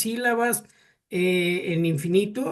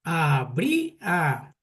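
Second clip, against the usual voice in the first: "Habría" said very slowly and carefully; patient teacher stretching "Habría"; slow syllable demo; 3 semitones lower, much slower than usual. Shown as a demonstration. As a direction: draw out "Habría" for counting syllables. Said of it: "Sotero, ¿cuántas sílabas lleva?".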